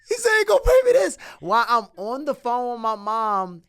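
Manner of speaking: high, loud shouted news; excited yell. Retold or imitated. Telling mom about the pay, a high excited yell.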